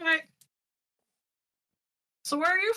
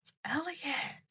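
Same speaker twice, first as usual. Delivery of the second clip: hushed, voiceless whisper; 9 dB quieter than usual. Acted. Player whispering in Mai's ear who that is, whispered. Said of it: "I whisper in Mai's ear".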